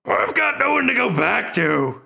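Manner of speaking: high slow rough orc voice; gruff half-orc voice; Ront voice. Acted. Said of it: "these voices are really taking a toll".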